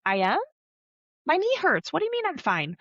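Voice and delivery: put-on high-pitched doubtful kid voice; questioning kid. Kid's thoughts after "You're fine", mock puzzled protest. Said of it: "inside their head, they're like".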